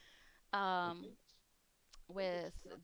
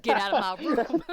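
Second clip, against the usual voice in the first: loud, laughing shout as the cat; 4 semitones higher, 13 dB louder than usual. Acted. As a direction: bellow it at the owner, laughing. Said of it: "It's like".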